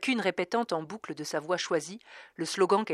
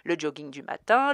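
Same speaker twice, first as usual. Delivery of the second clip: usual speaking voice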